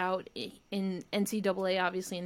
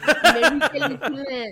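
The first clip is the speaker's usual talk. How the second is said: put-on Marie-Philippe Poulin in a louder, higher French accent; 6 semitones higher, 11 dB louder than usual. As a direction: say the name the French way. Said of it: "Yeah, Poulin".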